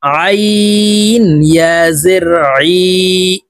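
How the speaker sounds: slow, drawn-out letter-by-letter recitation; Qaida spelling drill. Shown as a demonstration. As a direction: stretch the long vowels on a level tone. (ধীরে টেনে টেনে কায়দার বানান পাঠ)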